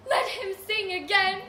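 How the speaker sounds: loud, high excited call; cheering crowd shout. Retold or imitated. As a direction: yell it like a thrilled host. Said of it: "the disco duke will say".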